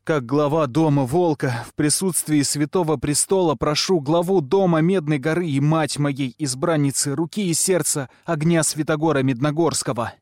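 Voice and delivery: loud, high formal blurt; blurted proposal. A narrator's reading. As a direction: fire it all out in one breath. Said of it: "набрал воздуха и выпалил". (выпалил громко и торжественно)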